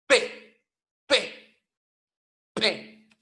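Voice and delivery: P golpeada y fuerte; P explosiva. Shown as a demonstration. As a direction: golpea la P contra la hoja. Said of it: "golpeamos la consonante explosiva". (P explosiva, golpeada)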